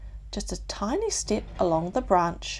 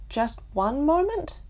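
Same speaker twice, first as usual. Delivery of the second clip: hesitant; 9 semitones higher than usual. A narrator's reading. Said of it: "he asked".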